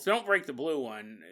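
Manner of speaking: normal talking voice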